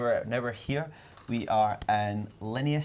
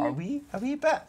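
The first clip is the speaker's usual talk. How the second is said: "a wee, a wee bit" in a much higher, squeaky put-on voice; a high mock "wee" to tease a friend; mock high squeak; 12 semitones higher than usual. Mimicking the phrase back, teasing.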